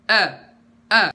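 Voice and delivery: loud "A" with the airflow held back; clear, strong hamzah. Shown as a demonstration. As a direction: hit "A" as a firm glottal stop, no breath escaping. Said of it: "dengan menahan aliran suara".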